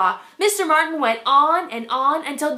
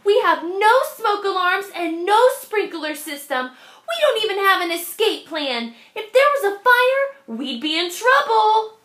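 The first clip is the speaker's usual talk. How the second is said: higher and louder, worried urgency; urgent announcement; 7 semitones higher, 5 dB louder than usual. A narrator's reading. Jessica warning her family at dinner, urgent and loud. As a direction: sound the alarm to the whole family. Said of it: "Jessica announced".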